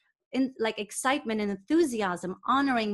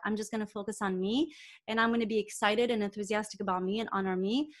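ordinary talk, nothing marked

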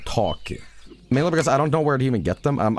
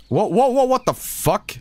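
startled; 10 semitones higher than usual. Real, not acted. Startled by something in game.

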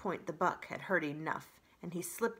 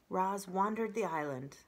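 usual speaking voice

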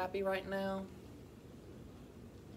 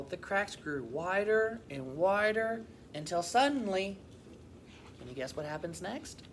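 lively, expressive picture-book reading; animated storybook voice; children's story narration; 5 semitones higher, 3 dB louder than usual. A narrator's reading. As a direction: read to young children with clear, lilting emphasis. Reads a picture book aloud.

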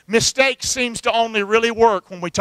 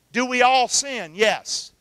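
ordinary delivery

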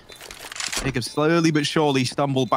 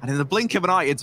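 higher, hyped caster call; excited play-by-play; 7 semitones higher than usual. Real, not acted. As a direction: ride the highlight with energy. Live play-by-play highlight.

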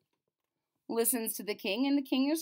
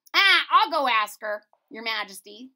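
jester voice; 13 dB louder than usual. Acted.

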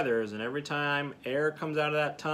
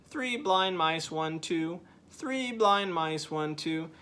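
three blind mice chanted slow and higher, then one, two; five-note rhythm; 5 semitones higher, slower than usual. Shown as a demonstration. Teacher chanting the five-note cadence rhythm, slow and higher. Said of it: "So now it's".

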